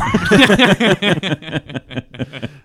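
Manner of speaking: loud amused laughter. Real, not acted. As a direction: burst out laughing. Laughing at voicemail.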